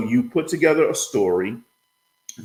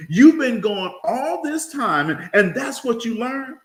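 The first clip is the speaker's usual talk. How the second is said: high, drawn-out mock disbelief; indignant grandfather; 11 semitones higher, slower than usual. Uneducated grandfather hears the boy's algebra, high-pitched indignation. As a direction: scold the boy in comic outrage.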